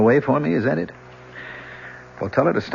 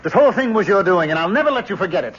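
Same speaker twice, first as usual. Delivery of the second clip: bitter outburst; 6 semitones higher, faster than usual. Acted. Prisoner flinging the blame at the professor, bitter and rising. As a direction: throw the blame in his face.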